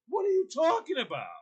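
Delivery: high-pitched mock exasperation; exasperated retold protest; getting mad. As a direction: act out mounting frustration, pitch jumping up. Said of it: "I'm getting, I'm getting mad".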